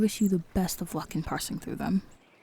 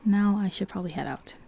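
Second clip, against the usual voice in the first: ordinary talk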